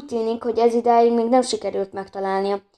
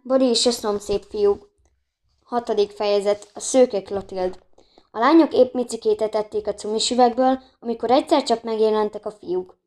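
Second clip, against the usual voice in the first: ordinary delivery, nothing marked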